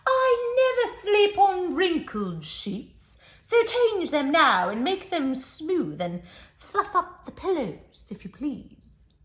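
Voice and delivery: high, loud, slow fussy demand; a pampered kangaroo lays down the law. Acted. Kangaroo orders the child to change the sheets, high and prissy. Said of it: "and says".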